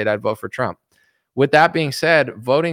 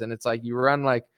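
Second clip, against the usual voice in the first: normal talking voice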